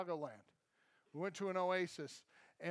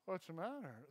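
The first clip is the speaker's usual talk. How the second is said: soft, listless mumbled question; shell-shocked, sluggish delivery; imitated lethargy; 3 semitones lower, 7 dB quieter than usual. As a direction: sound dazed and barely bothered. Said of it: "like, lethargic".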